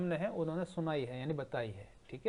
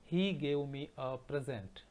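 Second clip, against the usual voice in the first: slow dictation; much slower than usual. Shown as a demonstration. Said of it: "teacher dictates sentence to class".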